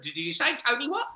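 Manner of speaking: ordinary talk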